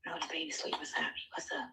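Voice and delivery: real hushed whisper. Real, not acted. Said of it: "You whispering, you're whispering".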